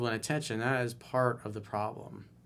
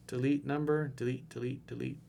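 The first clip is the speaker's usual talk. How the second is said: fading deletes; 6 dB quieter than usual. Real, not acted. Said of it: "fading dismissive repeat".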